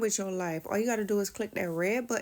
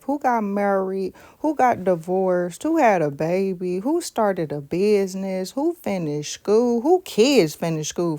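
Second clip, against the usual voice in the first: loud call-outs; 6 dB louder than usual. Real, not acted. Calling out questions to viewers.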